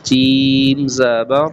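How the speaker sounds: each letter stretched very slowly; drawn-out spelling. Shown as a demonstration. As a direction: spell it out slowly and clearly, like a reading teacher. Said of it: "آپ جوڑ اور رواں بھی کر سکتے ہیں".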